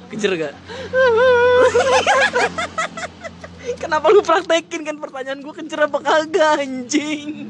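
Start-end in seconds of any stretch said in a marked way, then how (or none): 0.6-3.7 high, breathless wheezing laugh; a real fit of squealing laughter. Real, not acted. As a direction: laugh so hard the voice cracks up high. Friend mocks crying story.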